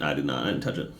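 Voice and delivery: deep, quiet dismissal; low grumble. Real, not acted. Dismissing the Battlefield beta.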